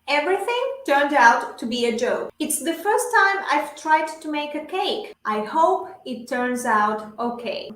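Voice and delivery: slow, carefully paced English model sentence; measured language-teacher read-aloud; shadowing model reading. Shown as a demonstration. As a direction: read it slowly for learners to repeat. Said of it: "yo leo las frases y ustedes repiten".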